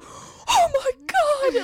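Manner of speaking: squealing delight. Retold or imitated. Fan recognized her in elevator.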